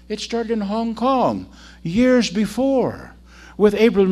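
rousing preaching. Real, not acted. Preacher telling how the mission started, rousing. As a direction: build loudly to the verse. Builds to the verse.

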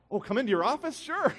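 high-pitched, flustered agreement; giddy, pitch leaping up; starstruck surprise. Retold or imitated. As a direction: squeak up in disbelief, then eagerly agree. Acts out his starstruck reply.

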